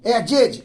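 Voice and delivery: एजेज as "e-jej", closing on a voiced j; two-syllable j ending. Shown as a demonstration. Teacher insists on the only reading, एजेज with a final j. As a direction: say एजेज in two beats, j in both. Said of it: "क्या पढ़ेंगे".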